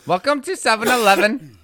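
loud, high Indian shopkeeper; Indian accent. Shown as a demonstration. Shop clerk greets a customer, Indian accent. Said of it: "I was working on an Indian one".

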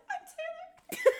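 squeaky high, slow and drawn out. Shown as a demonstration. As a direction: stretch it out in a tiny high squeak. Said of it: "You have to say it like that".

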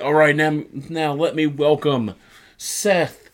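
hammed-up slow, rising introduction; mock ring introduction. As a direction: build to a high, drawn-out name. Imitating wrestler's ring introduction.

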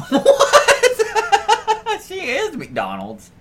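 high burst of laughter into words; laughing disbelief. Real, not acted. Co-host reacts to the McDonald's joke, laughing. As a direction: crack up while saying it.

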